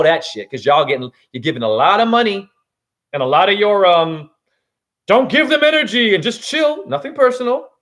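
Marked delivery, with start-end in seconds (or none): 5.1-7.7 mocking advice.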